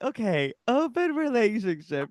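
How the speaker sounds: high, loud mock pondering; imagined diary writer. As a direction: act out agonizing over a diary entry. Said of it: "writing it in his diary. He's like".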